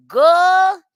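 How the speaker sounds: loud exclaim. Real, not acted.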